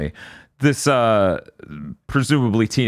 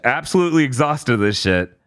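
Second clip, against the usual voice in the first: ordinary talk, nothing marked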